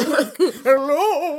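high, loud spooky ghost hello. Retold or imitated. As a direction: moan it like a laughing ghost. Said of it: "in a ghost voice".

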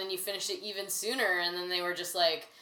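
ordinary talk

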